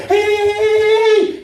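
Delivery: rapid-fire high "hey" yells; speeding-up string of high hollers. Retold or imitated. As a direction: repeat it in a high, quickening panic. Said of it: "the tempo gets faster".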